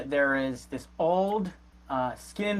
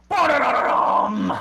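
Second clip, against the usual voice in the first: loud, high, rolled mock drumroll; mock fanfare; 12 semitones higher, 7 dB louder than usual. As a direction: belt out a silly dramatic sting. Game master mimics a fanfare.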